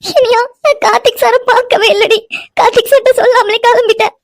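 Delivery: high-pitched tearful wail; crying. Acted. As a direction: sob the words out in distress. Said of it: "எதுக்கு இப்படி அழுதுட்டு இருக்கா". (அழுதுகொண்டே புலம்பும் குரல்)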